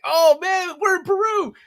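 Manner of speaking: high, loud, thrilled exclamation; excited. Retold or imitated. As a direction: squeal with joy at seeing home on screen. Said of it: "I'm like".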